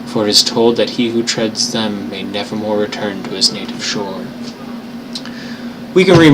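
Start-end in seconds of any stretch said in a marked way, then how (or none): 0.1-4.2 put-on measured storybook reading of old prose; story narration. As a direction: read it like a tale by the fire. Reading Lovecraft aloud on stream.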